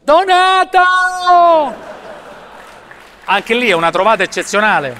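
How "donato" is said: long drawn-out high shout of the name; calling out to director. Retold or imitated. TV host hollering across the set for his director, drawn-out shout. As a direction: yell the name across the studio, stretched long.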